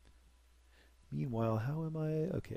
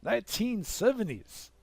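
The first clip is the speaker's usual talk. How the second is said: high, slow, put-on announcer voice; era announcement; 10 semitones higher, slower than usual. Gamer announcing the new era in a mock announcer voice. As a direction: announce the decade like a grand game narrator.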